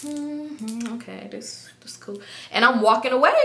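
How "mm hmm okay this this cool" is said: very soft, muttered acceptance; feigned nonchalance. Acted. Woman edging away from a confrontation, quietly nonchalant. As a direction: mumble it quietly as if all is fine.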